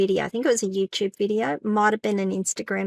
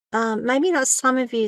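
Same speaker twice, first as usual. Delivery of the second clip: normal talking voice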